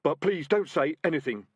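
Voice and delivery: hurried. A narrator's reading. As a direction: rush the plea out. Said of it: "added hurriedly".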